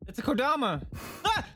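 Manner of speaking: high startled exclaim; startled cry. Real, not acted. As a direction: blurt it out in sudden surprise. Startled by game creature.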